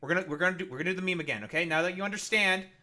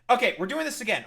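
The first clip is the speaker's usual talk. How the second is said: louder, higher, fed up; loud exasperation; 7 semitones higher, 9 dB louder, faster than usual. Real, not acted. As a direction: announce the restart, fed up. Exasperated restart.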